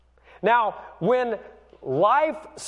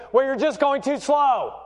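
higher, impatient mock retort; impatient wife; 7 semitones higher than usual. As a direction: imitate an exasperated wife, pitched up. Impatient wife's retort, acted.

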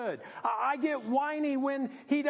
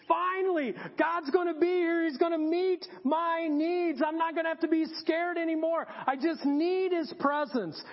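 higher, eager burst of hope; excited relief; 5 semitones higher than usual. Acted. Elijah expecting God to meet his needs, excited. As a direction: blurt it out as if rescue has arrived. Elijah's hopeful thoughts, acted out.

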